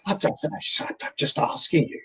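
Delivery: breathy hushed mock-camp aside; a hint of a mincing husband; camp whisper. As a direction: whisper it sweetly like a pretend partner. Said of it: "he said".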